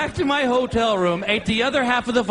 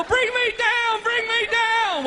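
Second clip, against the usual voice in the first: much higher, slow, begging; high slow terrified begging; frightened plea; 13 semitones higher, slower than usual. Retold or imitated. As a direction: plead to be let down in panic. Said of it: "I go".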